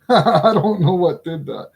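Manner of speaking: talking through a laugh. Real, not acted. Teacher baffled by a sudden cut, laughing. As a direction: let a real laugh run through the words. Puzzled laugh after cutting self.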